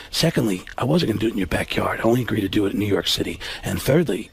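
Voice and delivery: a hint of sincere Baldwin explaining; Alec Baldwin impression. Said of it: "he said".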